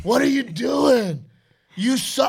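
loud, high mock yell at his son; mock angry dad yell. Dad mocking a yelling father at practice, mock shout. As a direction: bark it like a frustrated little-league dad. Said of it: "I do not hit him with the".

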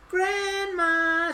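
put-on high, girlish call. A narrator's reading. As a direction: call out for someone in the house. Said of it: "she called".

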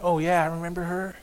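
hushed passing thought; musing to oneself. Acted. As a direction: drift into a private memory, softly.

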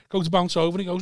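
ordinary talk

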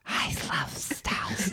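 slow hissing whisper of a classic snake; drawn-out s like a snake. Retold or imitated. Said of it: "classic snake voices".

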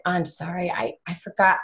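soft, low-key apology; apologetic husband. Retold or imitated. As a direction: admit it quietly, imitating a guilty husband.

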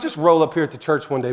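usual speaking voice

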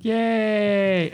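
drawn-out high yay; playful high-pitched yay; mock cheer. Mock cheer after grim reading.

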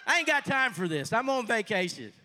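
high, loud, put-upon protest; hammed-up exasperated complaint; acted indignant refusal. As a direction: brush it off loudly and high. Acting out his own refusal.

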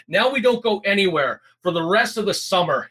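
understated father's rant; narrated scream. A narrator's reading. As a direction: lay down the punishment with contained fury. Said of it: "he screamed".

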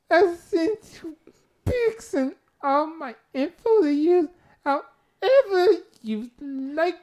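high, loud, tearful mock plea; put-on pleading reader voice. Host reading the man begging with his pics, hammed-up tearful squeak. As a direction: drag it out in squeaky falsetto. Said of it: "I gotta get the voice".

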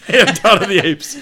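real amused laughter; laughing. Real, not acted. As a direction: giggle it out at the joke. Laughing at friend's joke.

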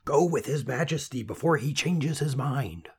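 high, urgent father's voice; excited whisper, voiced. A narrator's reading. Weaver hurrying his daughter off with the king, excited stage whisper. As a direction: push her along in a hushed fluster. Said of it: "in his excitement".

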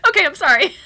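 put-on high, loud, laughing little-kid apology; voicing a cowed child. Mom playing her startled toddler giving in, comic child voice.

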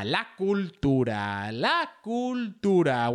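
slow, drawn-out Spanish title call; drawn-out jingle-like title, rising on the repeat; segment title announcement. Pretend. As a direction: announce the segment title grandly, stretching each vowel.

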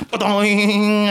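high, ringing cartoon boing; imitated bonk. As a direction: sing out a springy impact noise. Imitates bench hitting page boy's head.

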